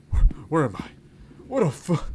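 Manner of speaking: high, put-on squeak of shock; mock startled. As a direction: yelp it in fake alarm. Waking in a strange place.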